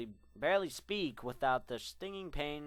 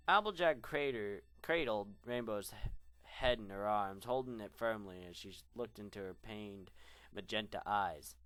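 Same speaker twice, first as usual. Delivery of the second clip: ordinary delivery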